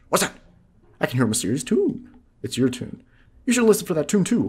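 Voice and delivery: mysterious forest guide reading his lines; high NPC voice. Acted. Voicing forest NPC dialogue.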